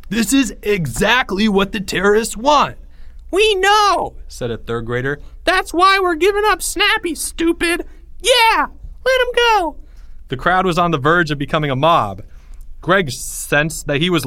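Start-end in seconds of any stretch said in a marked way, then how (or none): 0.1-2.8 raised, exasperated holler; bellowing. A narrator's reading. Greg argues against releasing Snappy, bellowing. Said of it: "Greg bellowed". 3.3-4.1 put-on very high, loud kid retort; yelling child. A narrator's reading. Third grader talks back to Greg, yelling. 5.4-9.8 put-on very high, loud kid retort; yelling child. A narrator's reading. Third grader talks back to Greg, yelling.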